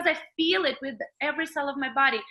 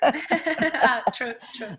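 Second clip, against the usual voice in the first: laughing; much slower than usual. Real, not acted. Laughing at the joke.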